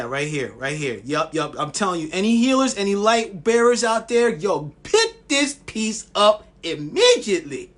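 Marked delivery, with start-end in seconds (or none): 2.1-7.7 rally every healer out there; passionate call out. Real, not acted. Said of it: "Yes, I said it like that because I'm, I'm passionate about it".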